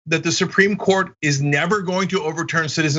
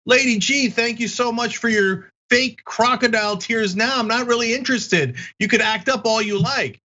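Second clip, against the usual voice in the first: higher, hammed-up whimpering senator mocking fake tears; mock crying Lindsey Graham; 7 semitones higher than usual. Host mocks a senator losing his money edge, higher mock-sob voice. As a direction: play the crybaby politician, pitched up, milking a boo-hoo. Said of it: "he's like".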